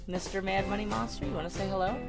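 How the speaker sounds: ordinary delivery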